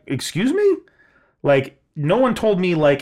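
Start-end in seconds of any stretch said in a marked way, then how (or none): 0.1-0.8 high, rising outraged disbelief; incredulous excuse me. Retold or imitated. Retelling the manager's offer, a high incredulous squeak. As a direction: soar up in shocked disbelief. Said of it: "And I'm like".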